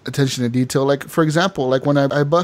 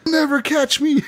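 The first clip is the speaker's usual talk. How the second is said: defiant taunt; 11 semitones higher than usual. Pretend. Running from police, defiant.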